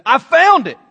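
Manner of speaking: loud, high cry of discovery; high, loud retold shout; excited shout. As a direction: call it out excitedly as the finder. Team member announcing a find.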